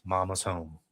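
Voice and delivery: low, soft menace; possessed Ellie quote. Acted. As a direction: announce it quietly and low. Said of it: "she pretty much goes".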